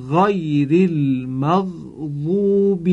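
غیر with a throaty Arabic gh, slow and drawn out; correct ghayn. Shown as a demonstration. Teacher shows correct ghayn to students, slow recitation. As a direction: say غیر المغضوب slowly with a true ghayn. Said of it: "توجه بفرمایید".

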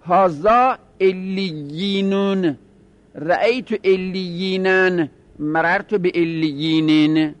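«رأیتو زیدینن» spoken slowly, every case ending voiced; slow declension drill. Shown as a demonstration. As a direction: read the inflection table slowly, one case per line.

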